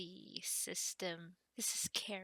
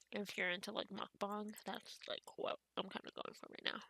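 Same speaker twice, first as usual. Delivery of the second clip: ordinary talk, nothing marked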